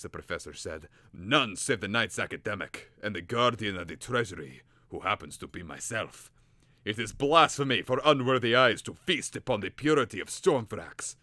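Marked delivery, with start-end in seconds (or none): 1.1-11.2 loud, building professor's rant; angry rant. Acted. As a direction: rant at the youth, getting louder. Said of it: "he ranted".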